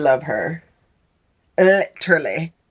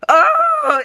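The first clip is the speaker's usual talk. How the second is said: emotional squeal; 4 dB louder than usual. Real, not acted. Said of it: "I'm screaming".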